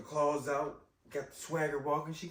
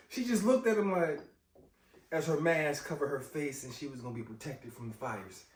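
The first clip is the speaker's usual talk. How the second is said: usual speaking voice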